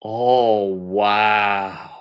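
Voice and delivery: ordinary talk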